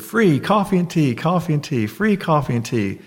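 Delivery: light imitation of a happy coffee server; playful vendor call. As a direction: call out the offer like a cheerful waiter. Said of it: "sort of dancing".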